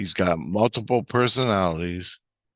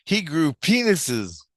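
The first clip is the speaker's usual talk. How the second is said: mild mock yell, high and slow; retold scream; 8 semitones higher, slower than usual. Girl blurts out the prince's secret, mock screaming. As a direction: imitate a girl calling it out, drawn out without real volume. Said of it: "screams out".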